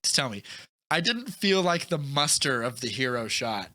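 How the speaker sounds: ordinary talk, nothing marked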